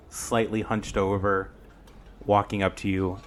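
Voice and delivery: ordinary delivery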